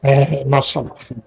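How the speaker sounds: ordinary delivery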